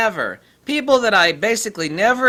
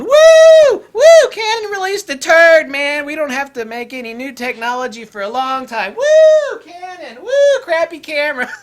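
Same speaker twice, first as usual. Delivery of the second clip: wild, much higher and louder whooping; drunken party whoops; 8 semitones higher, 10 dB louder than usual. Retold or imitated. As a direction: yell like drunk revellers toasting a rival's flop. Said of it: "drunken orgy parties going".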